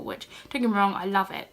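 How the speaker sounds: ordinary delivery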